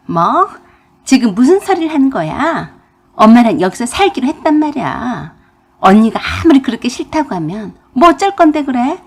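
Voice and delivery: indignant retort. A narrator's reading. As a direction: argue back hotly as the defiant sister-in-law. Sister-in-law talks back in quarrel.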